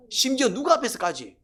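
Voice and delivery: ordinary delivery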